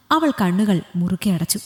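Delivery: usual speaking voice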